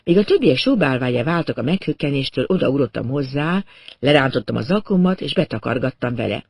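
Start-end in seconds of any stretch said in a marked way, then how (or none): none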